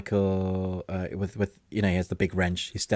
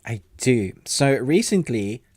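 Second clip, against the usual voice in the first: normal talking voice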